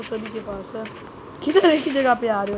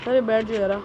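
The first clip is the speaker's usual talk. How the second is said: ordinary delivery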